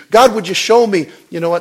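high, loud fiery sermon delivery; full-force preaching. Acted. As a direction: preach it out, building to a roar.

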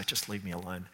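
soft, wounded plea to be left alone; a hurt friend pushing you away, softly; quietly hurt. Retold or imitated. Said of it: "really hurt and they say".

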